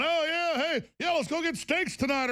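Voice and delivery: high, breezy casual act; mock carefree calm. Host imitating himself just sitting here, mock carefree. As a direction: chirp it lightly, as if nothing's wrong. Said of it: "I'm just sitting here calmly going".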